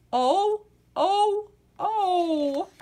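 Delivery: high loud hoots; rising hooting oh. Acted. Describing baby orangutans, high playful hooting. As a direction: hoot like a little orangutan.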